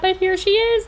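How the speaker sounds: loud, climbing to a high peak; excited reveal. Real, not acted. Woman unveiling her finished sectional to viewers, excited reveal. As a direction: build up to a big, high-pitched ta-da.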